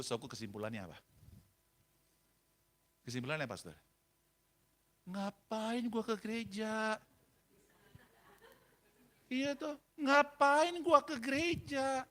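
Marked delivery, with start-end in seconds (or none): 5.1-12.1 slow, high, drawn-out mockery; dismissive drawl. Husband concluding church is pointless, dismissive drawl. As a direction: imitate a man scoffing at the idea.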